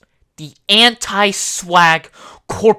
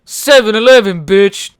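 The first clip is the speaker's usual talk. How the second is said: louder yelled line; shouted punchline; 3 semitones higher, 8 dB louder than usual. Acted. Joking about store, loud shout. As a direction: belt the punchline.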